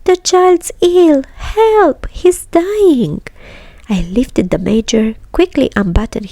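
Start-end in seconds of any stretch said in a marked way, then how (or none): 0.1-3.2 much higher, slow desperate cries for help; panicked screaming. Acted. Mother screams for help over her collapsed son, much higher and panicked. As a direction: scream for help as if the child is dying. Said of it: "screamed the mother".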